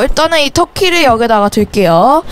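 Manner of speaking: usual speaking voice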